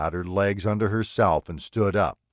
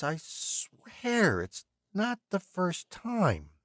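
higher, slowing, fading to soft; eerie musing; 9 semitones higher, 3 dB quieter, slower than usual. A narrator's reading. Villain muses at the window, high and fading. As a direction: puzzle it out dreamily, going quiet.

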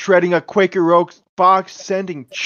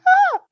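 sudden high yelp of surprise; awkward startled scream; 7 dB louder than usual. Pretend. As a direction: caught off guard, short scream. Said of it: "Awkward scream?".

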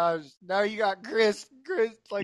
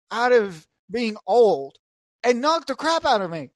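ordinary delivery

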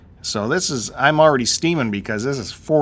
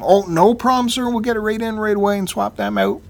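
high-pitched imitation of an accommodating clerk; eager-to-please clerk; 9 semitones higher, 3 dB louder than usual. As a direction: placate the customer in a raised pitch. Clerk's reply, voiced by teller.

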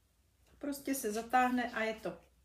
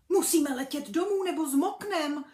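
higher, louder and quick; urgent little bee; 6 semitones higher, 7 dB louder than usual. Acted. Brunda urges flying home before the rain, higher, louder and quick.

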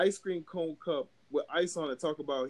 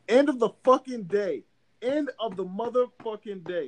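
loud, higher exasperated outburst; emphatic rant; 5 semitones higher, 4 dB louder than usual. Real, not acted. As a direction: hit it hard, fed up. Emphatic rant about long day.

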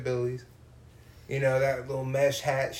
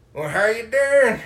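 Southern drawl; 9 semitones higher, 8 dB louder than usual. Retold or imitated. Bubba on the porch greeting the pizza driver, hammed-up Southern drawl. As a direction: ham up a big porch-sitting country man. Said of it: "He's sitting on the porch".

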